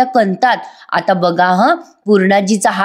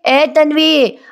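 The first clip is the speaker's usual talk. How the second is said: higher call across the house; 6 semitones higher, much slower than usual. Retold or imitated. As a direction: call Tanvi from the other room. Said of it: "Purnaji आवाज देतात".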